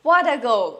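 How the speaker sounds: loud delighted cheer; excited fan cheer; thrilled fan. Shown as a demonstration. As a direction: cheer a great goal. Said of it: "তখন আমরা বলতে পারি".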